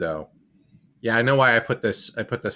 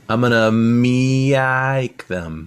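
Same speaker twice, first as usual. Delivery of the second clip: very slow, stretched "me-ike" like a meow; cat pun voice; 7 dB louder, much slower than usual. Pretend. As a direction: draw "me-ike" out into a cat's mew, louder. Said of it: "Level of puns".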